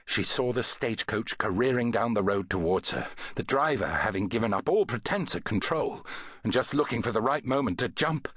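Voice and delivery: ordinary delivery, nothing marked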